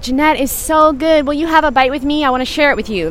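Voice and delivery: a hint of a sister begging to share; imitating little sister. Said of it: "She was like".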